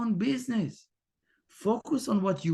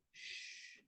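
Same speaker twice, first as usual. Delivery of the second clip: unvoiced soft shush; quiet "shh" to still the mind; 26 dB quieter than usual. Shown as a demonstration. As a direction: hush yourself. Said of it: "Tell yourself".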